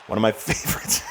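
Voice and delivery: real laughing fit while talking; breaking into laughter. Real, not acted. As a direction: laugh bursting through the words. Cracks up mid-commentary.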